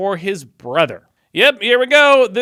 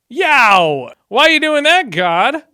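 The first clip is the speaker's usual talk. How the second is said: high, loud indignant yelp at God; shocked outcry; 9 semitones higher, 8 dB louder, slower than usual. Real, not acted. Reading a harsh verse, he yelps at God in loud, high shock. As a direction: cry out in disbelief at the unfairness. Outraged reaction to God's killing.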